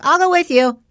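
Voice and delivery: playfully imitating an eager son; eager kid voice. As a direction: pipe up like a keen kid tagging along.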